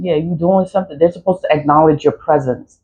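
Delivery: ordinary delivery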